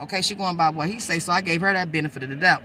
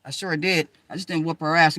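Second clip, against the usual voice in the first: normal talking voice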